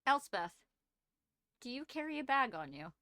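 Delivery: ordinary delivery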